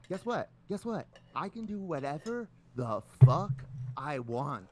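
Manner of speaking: high, heated retort building to a loud finish; angry. Retold or imitated. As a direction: reenact getting angry and defiant. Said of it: "I just start getting angry".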